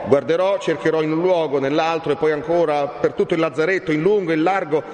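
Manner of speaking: high, urgent rush of resolve; distraught young man. A narrator's reading. Renzo vows to search the whole lazzaretto, distraught. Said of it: "cambiandosi tutto in viso".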